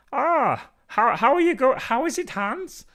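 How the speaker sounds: hammed-up German accent, pitched high and loud; a jolly German fan greeting his mate in a bad German accent; terrible German accent. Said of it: "another terrible accent".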